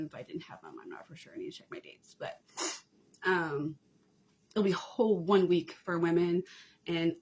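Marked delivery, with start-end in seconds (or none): 0.0-2.4 muttering to herself under her breath; whispered aside. Real, not acted. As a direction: whisper it as a side thought.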